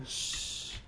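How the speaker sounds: long, soft hissed shush. Acted. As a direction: hush it with a drawn-out shh. Mimed hushing the trailer voice.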